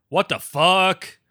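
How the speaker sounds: high, loud and slow review readout; loud, drawn-out exclamation. Acted. Reader quoting a user's review, loud mock outrage. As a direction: belt it out slowly in mock outrage.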